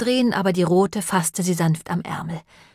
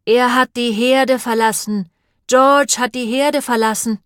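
put-on loud, high bleating old sheep; raised, slow sheep-like cry; Sir Richfield; 5 semitones higher, 6 dB louder than usual. A narrator's reading. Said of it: "blökte Sir Richfield".

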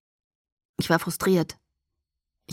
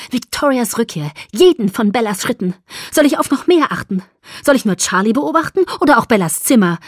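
rising louder, cold anger; 6 semitones higher, 6 dB louder than usual. Acted. Alice answering Edward, cold then loud. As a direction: build from cold to loud fury. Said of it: "Mit kalter Stimme antwortete Alice".

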